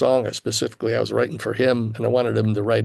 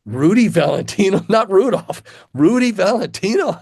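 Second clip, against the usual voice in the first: higher, louder incredulous echo of the name; mock disbelief; 8 semitones higher, 4 dB louder than usual. Young guitarist marvelling at his rival's name, high mock disbelief. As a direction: repeat the name like you can't believe it.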